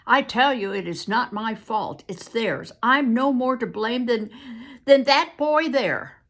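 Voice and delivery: loud, high insisting protest. Acted. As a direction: plead it is not your fault. Captive protests it's not his fault.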